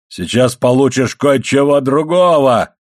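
slow, drawn-out threatening roar; angry yell. A narrator's reading. Father threatening his son, yelling angrily. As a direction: bellow it, swelling on the last word. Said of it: "заорал Моррелл".

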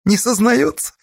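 with a touch of regret, pitched high; regretful. A narrator's reading. Merkul reports the captive won't confess, mildly regretful. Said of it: "с сожалением сказал Меркул".

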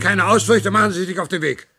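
stern command; sharp order. Acted. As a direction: cut off his excuses. Ordering him out.

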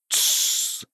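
soft voiceless hush; hushing shh. Acted. Vanity silencing the other sins, hushing. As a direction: silence them with a quiet shush. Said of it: "Тщеславие приложил палец к рту".

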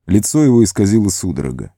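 ordinary delivery

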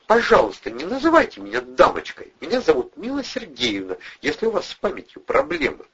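high-pitched, annoyed woman's comeback; testy and affronted; irritated retort. A narrator's reading. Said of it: "раздражённо огрызнулась Мила".

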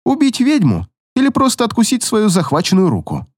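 plain reading voice

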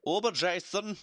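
high mock German; a hammy German accent. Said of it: "a German accent just so I could say Uber Jason".

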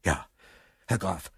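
very soft, low, faint reply; breathed. A narrator's reading. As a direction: barely breathe out the answer, halting. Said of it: "hauchte dieser". (gehaucht, kaum hörbar)

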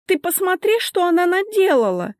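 high, loud indignant woman; accusing victim. A narrator's reading. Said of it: "занять более выгодную позицию жертвы". (возмущённая жертва, высоким голосом)

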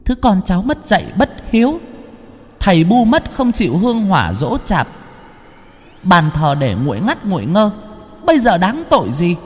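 put-on high, sharp scolding child's voice; a young girl scolds hotly from her seat. A narrator's reading. Girl ancestor scolding her descendant, high and stern. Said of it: "mà quát".

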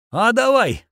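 high, peppy agreement; cheerful, game reply. A narrator's reading. Torch agreeing to his friend's joint attack, peppy reply. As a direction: jump at it eagerly. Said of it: "бодро отозвался он".

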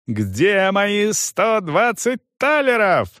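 high, slow outraged question; furious demand. A narrator's reading. Young man demands his money, furious. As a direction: demand it, stretching each word. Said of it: "орал он".

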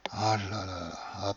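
a soft, thought-only sigh; inner sigh. Acted. Husband thinking in dismay as she drifts off, soft sigh. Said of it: "pensai-je".

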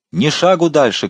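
high, forceful command; shout. A narrator's reading. Coadjutor halting the crowd below, shouted command. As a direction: call it out high to halt a crowd. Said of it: "крикнул коадьютор".

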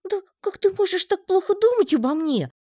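высоко и громко, возмущённый писк; возмутись во весь тонкий голосок; негодование. A narrator's reading. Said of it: "От негодования голос у паучка аж задрожал". (паучок возмущён подозрением: громкий высокий негодующий голосок)

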